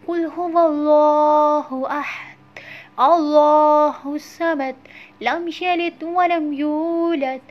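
slow, loud, melodic recitation; slow chanted tajweed recitation; full recitation. Shown as a demonstration. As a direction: recite the whole surah with full voice. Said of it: "मैं एक दफ़ा पूरी सूरत पढ़ती हूँ".

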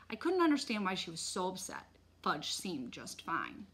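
ordinary delivery, nothing marked

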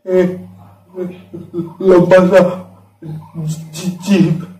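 halting, broken words, as if too scared to describe it; frightened stammering. Acted. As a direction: stammer in fear, pausing between fragments. Acting terrified recall of a monster. (डर से हकलाते हुए)

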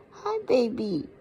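ordinary talk

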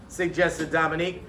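ordinary delivery, nothing marked

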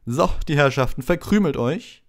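loud, high crowd dismissal; Lockhart calling out. A narrator's reading. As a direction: shoo the onlookers grandly. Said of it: "rief Lockhart den Umstehenden zu".

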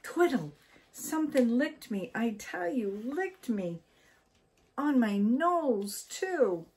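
high, loud frightened outburst. A narrator's reading. Wife wakes husband after something licks her nose, frightened. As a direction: tell him in alarm what just happened. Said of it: "in fright".

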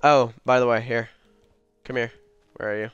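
ordinary talk, nothing marked

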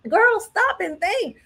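frantic pleading. Retold or imitated. Pleading with the girl on screen, very high frantic stops. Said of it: "I was like".

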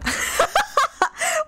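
squealing laugh; high-pitched giggle burst. Real, not acted. Laughs at her idea.

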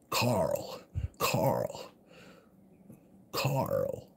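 put-on low, quiet, gruff call of a boy's name; Rick Grimes impression. Streamer doing Rick Grimes for chat, low and quiet. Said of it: "That's me Nick Grimes impression".

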